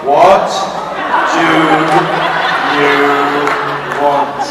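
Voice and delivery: each word spaced out, very slowly. Shown as a demonstration. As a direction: stretch every word apart with pauses. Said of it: "I'll speak slowly".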